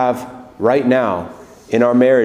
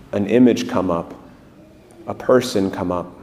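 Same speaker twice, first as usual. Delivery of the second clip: guided prayer; 3 semitones lower than usual. Acted. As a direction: lead the room gently into prayer.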